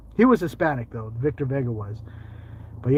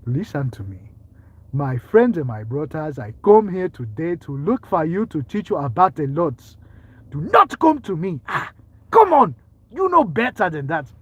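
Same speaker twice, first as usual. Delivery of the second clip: preacher with a put-on African accent, building to loud; 4 dB louder than usual. Preacher teaching his brothers about the Lord, mock African accent. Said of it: "The African accent?".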